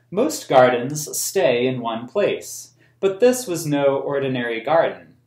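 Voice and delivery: put-on reading a picture book aloud to children; storybook reading for kids; story narration. A narrator's reading. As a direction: tell the story in a clear, even read-aloud voice. Reads the book's text aloud.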